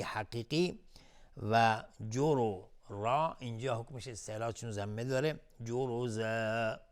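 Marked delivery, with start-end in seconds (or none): 5.6-6.8 «جرزا» slow, ending on a drawn-out aa; substitute madd. Shown as a demonstration. Teacher shows madd replacing tanween, final aa of «جرزا» lengthened. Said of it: "مد عوض".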